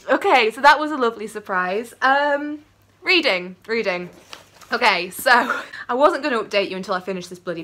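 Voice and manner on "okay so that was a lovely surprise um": ordinary talk, nothing marked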